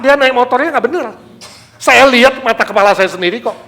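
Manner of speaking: loud, high, fast protest; can't believe the clerk apologised; furious defence of the clerk; indignant outburst. Acted.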